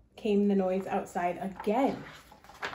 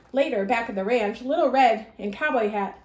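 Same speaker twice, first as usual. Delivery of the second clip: ordinary delivery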